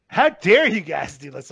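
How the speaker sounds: hammed-up scolding of the adults; mock outraged activist. Young activist scolding world leaders, hammed-up slow outrage. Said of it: "And she's like".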